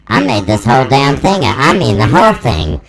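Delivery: normal talking voice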